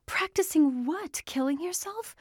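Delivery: near-hysterical, pitch shooting up. Acted. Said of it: "Her voice sounded hysterical".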